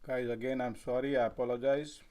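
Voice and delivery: talking with a mouthful of food; chewing while talking. Real, not acted. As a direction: chew on food while speaking. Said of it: "I'm chewing sound coming out".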